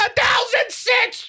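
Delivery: high, shouted mock indignation; mock outrage. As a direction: squeal it like a grave injustice. Repeating the joke louder.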